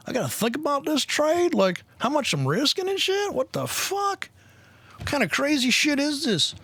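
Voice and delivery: mock confused trader. Streamer imitating the newbie trader who won't think, high mocking voice.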